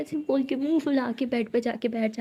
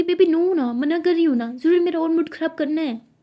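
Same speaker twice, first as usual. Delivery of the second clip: whiny protest; 5 semitones higher, 5 dB louder than usual. Acted. Siya pleading loudly to stop Tara.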